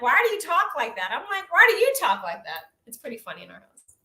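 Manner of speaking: ordinary delivery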